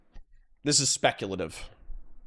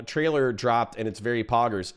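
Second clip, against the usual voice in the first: ordinary delivery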